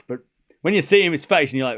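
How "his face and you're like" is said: normal talking voice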